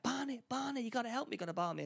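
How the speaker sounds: high, pleading cry for help; panicked pleading. Retold or imitated. Heroine begging her boyfriend Barney for help, panicked and high.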